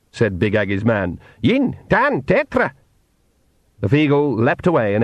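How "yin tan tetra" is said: high squeaky pixie countdown; much higher Scots pixie count. Acted.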